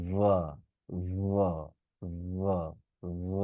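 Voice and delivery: ভ buzzed as a v with the throat vibrating, no air; voiced v demo. Shown as a demonstration. Teacher demonstrating the correct vibrating v sound. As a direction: hum the ভ as a voiced v. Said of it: "একটা vibration আপনি পাবেন এখানে".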